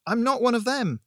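loud, high pleading; urgent, high-pitched appeal; desperate cry. A narrator's reading. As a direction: cry out and beg to be believed. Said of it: "I cried".

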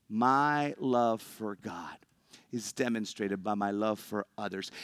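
slow and deliberate, word by word; slow, spaced reading. Shown as a demonstration. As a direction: let each phrase land slowly.

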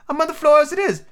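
high, cheeky reply; cheeky Hatter. Acted. Hatter talks back from the floor, high. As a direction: talk back to the king.

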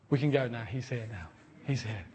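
low, hushed and awed; hushed awe. Retold or imitated. Shaken leader softly telling her the Spirit has come, hushed. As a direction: breathe it out in wonder. Awed man after the presence came.

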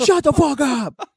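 high, drawn-out holler; yelling dad. Retold or imitated. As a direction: stretch out an angry shutdown.

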